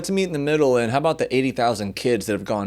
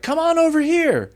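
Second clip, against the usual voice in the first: high-pitched mock invitation; put-on eager beckoning; mock welcoming call; 9 semitones higher than usual. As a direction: call them over, pitched way up. Voices America inviting migrants over.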